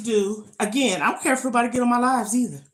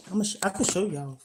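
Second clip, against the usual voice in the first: ordinary talk, nothing marked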